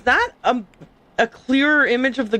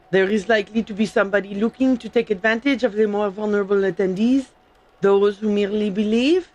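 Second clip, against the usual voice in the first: hammed-up French accent, reading in character; amateur mock-French accent; fake French accent. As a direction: put on a bad French accent. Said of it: "comfortable doing is the fake French".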